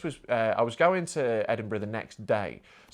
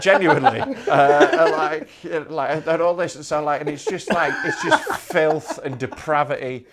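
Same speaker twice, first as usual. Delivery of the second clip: laughing; 2 semitones higher, 7 dB louder than usual. Real, not acted. Laughing about his filthy show.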